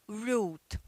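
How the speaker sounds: route rhyming with boot, British style; British English route; British pronunciation. Shown as a demonstration. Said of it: "E no inglês britânico".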